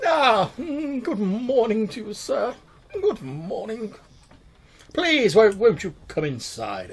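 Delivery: high, fussy, obsequious shopkeeper voice; fawning tailor. Acted.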